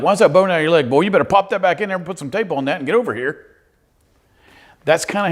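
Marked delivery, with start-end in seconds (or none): 0.0-3.5 loud, high, fast tough-dad bark; stern dad. Retold or imitated. Dad scolding his son over a broken leg, loud tough bark. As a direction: bark it like a no-nonsense father. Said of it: "He'd be like".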